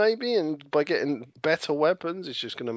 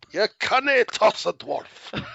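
high, slowish mock Scottish dwarf, a bit louder; bad Scottish accent; 9 semitones higher, 4 dB louder, slower than usual. Joining the dwarf joke in a hammy Scottish accent, higher and slow. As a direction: bellow it as a jokey Scottish dwarf. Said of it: "talk in a bad Scottish accent".

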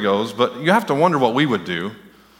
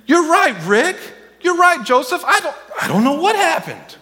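way higher, squeaky, helpless; mock clueless God. Preacher parodying a God who knows nothing, squeaky. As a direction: ham up a baffled God with a laugh. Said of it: "would be up there saying".

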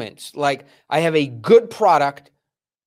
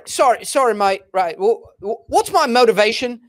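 imitated Oxford English actor, pitched up; higher-voiced mock Oxford accent, apologetic; 5 semitones higher than usual. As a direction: play the Oxford-accented actor asking for direction. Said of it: "he's got this perfect Oxford".

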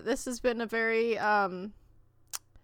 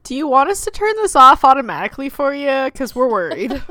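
concerned phone voice; 2 semitones higher, 10 dB louder than usual. Pretend. Voicing phone's concerned message.

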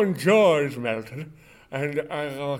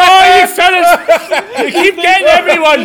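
laughing; 9 semitones higher, 9 dB louder than usual. Pretend. Laughing at prank.

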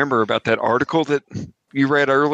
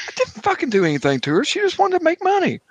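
defensive. Acted. Father defends himself, heated.

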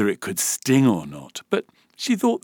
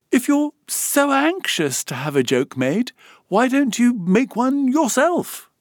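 put-on impatient girl; 6 dB louder than usual. A narrator's reading. Alice challenges the voice.

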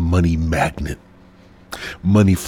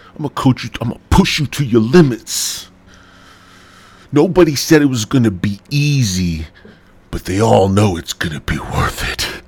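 loud motivational coach pitch; energy drink ad; 4 semitones higher, 4 dB louder than usual. Acted. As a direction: pump them up like a gym coach. Motivational coach pep talk.

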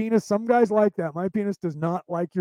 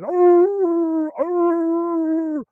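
long, high held howls; bay loudly up at something out of reach; dog barking; 11 semitones higher, 6 dB louder than usual. Acted. Dog barking at what ran up the tree, long high howls. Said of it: "I'll sit there barking at it".

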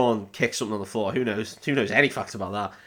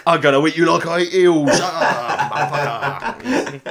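loud, high, hammed-up Cockney rant; 7 semitones higher, 7 dB louder than usual. Pursuer threatening to eat the fleeing hobbits, Cockney. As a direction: yell it like a cartoon Cockney thug. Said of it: "Classic Cockneys".

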